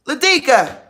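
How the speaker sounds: high holler for the dog; calling out. Real, not acted. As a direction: call the pet's name across the house.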